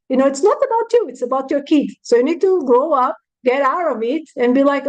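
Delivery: usual speaking voice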